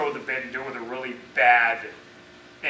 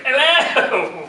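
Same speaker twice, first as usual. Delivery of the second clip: drawn-out, higher dramatic hello; 10 semitones higher, 4 dB louder than usual. Shown as a demonstration. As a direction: stretch the greeting out theatrically. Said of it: "we need a little bit more dramatic".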